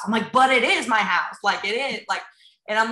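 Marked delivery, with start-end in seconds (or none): 0.3-1.4 pushed up high and loud on is; mock protest to husband, stress on is; insistent. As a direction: stubbornly insist it IS hers. Arguing back to husband.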